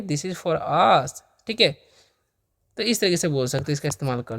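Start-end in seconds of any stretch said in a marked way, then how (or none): 0.0-1.1 धीमा स्पष्ट उच्चारण. Shown as a demonstration. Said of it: "तो क्या बोलेंगे?".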